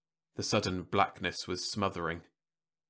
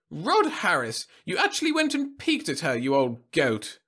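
put-on high, scolding old lady voice; scolding old woman; 8 semitones higher, 5 dB louder than usual. A narrator's reading. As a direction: chide him like an old lady.

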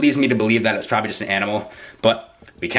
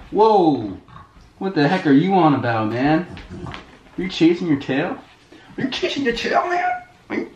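excited pet talk; 8 semitones higher than usual. Real, not acted. Owner squealing at his dog chasing its tail, excited. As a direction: playfully tease the spinning dog.

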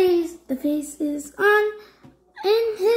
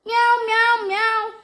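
high, playful mock meows; cat meow; 5 semitones higher than usual. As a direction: meow in a singsong pretend-cat voice. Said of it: "The cat says meow".

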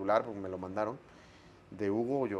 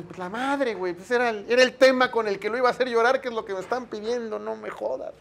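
much higher, frustrated muttering inside his head; exasperated inner rant; 10 semitones higher, 4 dB louder than usual. Retold or imitated. Host silently cursing the ruined plan, much higher exasperated rant. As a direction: vent at the ruined plan to himself. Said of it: "pero yo".

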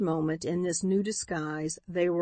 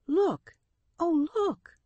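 high alarmed cry; shocked, pointing outcry; 11 semitones higher, slower than usual. A narrator's reading. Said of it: "cried Florence".

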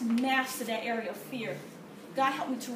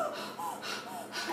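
winded imitated gasp after the swim; retold gasp for air at the pool edge; 7 semitones lower, 9 dB quieter than usual. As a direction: heave in a breath, out of breath. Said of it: "And I remember going".

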